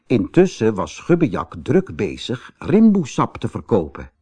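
ordinary delivery, nothing marked

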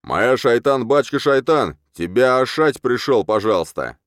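loud, high raving shout; wild yelling. A narrator's reading. Tarred wild man menacing the merchant, yelling. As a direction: holler it like a raving madman. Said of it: "заорал благим матом".

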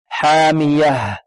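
very slow حامية ending in an audible h; clear final ha. Shown as a demonstration. Teacher isolates the word's ending, clear final ha. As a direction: stretch حامية and release the final h. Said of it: "والهاء هنا لابد من إيضاحها".

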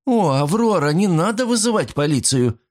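high, pleading companion voice; high-voiced worried plea; plaintive protest. A narrator's reading. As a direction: protest anxiously, pitched well up. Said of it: "запротестовала та".

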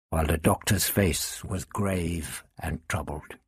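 ordinary delivery, nothing marked

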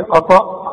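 "أطأ" thick and back in the mouth; wrong heavy hamza. Shown as a demonstration. Teacher scolds pupil by echoing error, heavy letters. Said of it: "والهمزة الثانية قلت لي".

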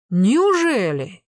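loud, long-drawn sarcastic question; scornful disbelief. A narrator's reading. Enforcer doubting the nephew's denial of theft, scornful disbelief. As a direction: draw the word out slowly, mocking. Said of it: "Клавдий хмыкнул".

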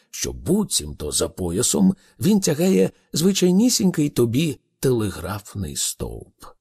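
ordinary delivery

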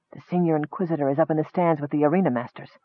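soft, fast mutter. Acted. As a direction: mutter it quickly under the breath. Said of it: "his companion muttered".

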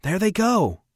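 high, loud, excited call; shout. A narrator's reading. Andrea alerts the crew on the cutter, shouting. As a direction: call it out across the bridge. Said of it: "Andrea shouted".

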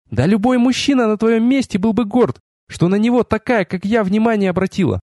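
high-pitched haughty female character; offended proud goddess. A narrator's reading. (уязвлённая богиня, высокий голос)

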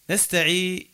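"نستعي" stopped on the long ee, no final N; cut-off word ending. Shown as a demonstration. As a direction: break off at "نستعي" before the N. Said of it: "كيفاش، كيفاش تقف يعني؟".

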